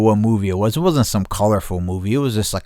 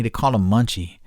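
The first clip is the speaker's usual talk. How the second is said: ordinary delivery, nothing marked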